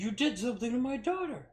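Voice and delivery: put-on father insisting the teen is guilty; accusing dad. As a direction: jab the accusation at him.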